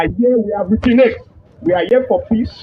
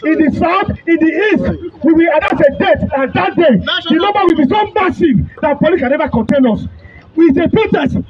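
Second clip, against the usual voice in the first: raised, high-pitched rallying cry to the crowd; protest rally speech; 8 semitones higher than usual. Real, not acted. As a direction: fire up the protesters.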